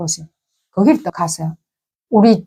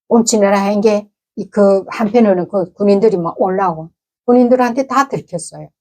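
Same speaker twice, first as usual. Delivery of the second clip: ordinary delivery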